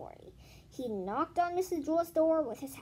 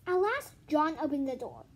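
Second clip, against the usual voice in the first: plain reading voice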